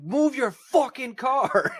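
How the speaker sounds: high, slow, stretched-out yell; retold yell. Yelling at the slow driver ahead, high drawn-out shout. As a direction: bellow it out the window. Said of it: "I laid on that fucking horn".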